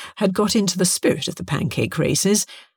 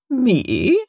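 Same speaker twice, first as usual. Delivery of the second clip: smug, raised-pitch questioning; 7 semitones higher than usual. A narrator's reading. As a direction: feign innocence with infuriating smugness. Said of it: "replied Irving Braxiatel with typical infuriating smugness".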